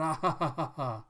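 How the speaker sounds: hammed-up laugh. Townsfolk laugh at Zoro.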